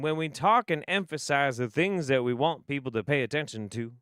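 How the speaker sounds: ordinary talk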